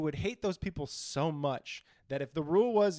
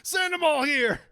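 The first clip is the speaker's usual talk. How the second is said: much higher, louder shout; mock outraged shout; 10 semitones higher, 5 dB louder, slower than usual. Yelling at foreign scammers, a mock outraged shout. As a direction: yell it at the scammers.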